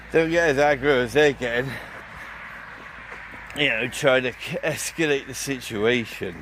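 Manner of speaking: ordinary talk, nothing marked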